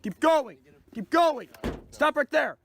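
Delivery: loud, firm police orders; shouting commands. Acted. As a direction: bark orders at the suspect. Officer shouting commands at suspect.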